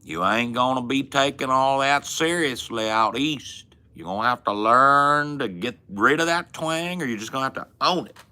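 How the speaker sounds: high, put-on Southern twang; folksy Southern twang. Shown as a demonstration. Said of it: "if you sound all folksy".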